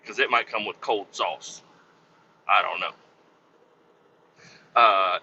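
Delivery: normal talking voice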